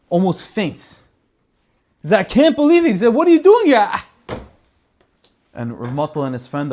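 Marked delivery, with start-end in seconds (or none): 2.2-3.9 much higher, loud disbelieving cry; overwhelmed that guests arrived; high shocked exclamation; astonished host. Retold or imitated. Stunned host opening door.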